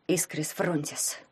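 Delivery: quiet, slow and firm. A narrator's reading. Vanka casts his spell at his rival, firmly. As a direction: cast the spell softly but steadily. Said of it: "твёрдо произнёс Ванька".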